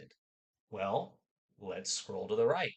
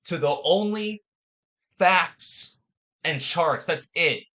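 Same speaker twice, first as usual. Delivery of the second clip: energetic show host; 10 dB louder than usual. Acted. Energetic show intro and disclaimer.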